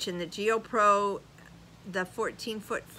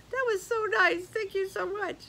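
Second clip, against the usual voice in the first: high, bright gushing thanks; delighted squeal; 12 semitones higher than usual. Real, not acted. Vlogger gushing high-pitched thanks to a kind viewer. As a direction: gush over the sweet comment.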